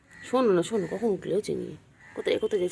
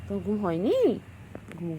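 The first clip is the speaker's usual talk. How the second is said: normal talking voice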